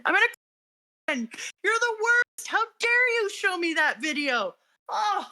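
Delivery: high-pitched, emotional wail; choked up. Real, not acted. Podcaster blaming co-host for the sad video, high tearful protest. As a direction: protest in a high voice, near tears.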